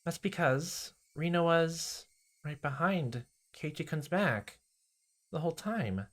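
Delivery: put-on high sweet girl voice, slow; a creepy sing-song girl; Rena, creepy sweet. Rena confessing she followed him, high and sweet.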